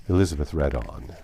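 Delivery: usual speaking voice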